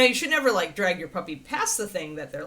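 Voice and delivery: ordinary talk, nothing marked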